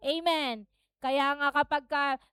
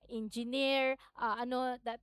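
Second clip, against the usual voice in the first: usual speaking voice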